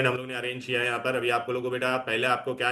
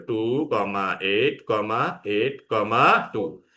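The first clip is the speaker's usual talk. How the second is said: very slow, each number spaced out; धीमा श्रुतलेख; slow dictation; 3 semitones lower, much slower than usual. Shown as a demonstration. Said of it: "dictating configuration".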